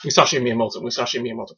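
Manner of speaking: name rattled off quickly; fast tongue-twister. Shown as a demonstration. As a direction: run the repeats straight together. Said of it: "Say that three times fast".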